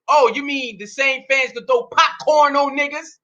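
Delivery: ordinary delivery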